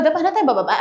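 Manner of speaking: high, giggly urging; laughing. Real, not acted. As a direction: break into laughter while speaking. Laughs while leaving the loft. (natatawa habang nagyayaya)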